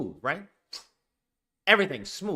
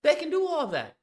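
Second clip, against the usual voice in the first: emphatic insistence; 9 semitones higher than usual. Real, not acted. To his chat about the game, high emphatic insistence. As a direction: pitch jumps way up, pressing the point.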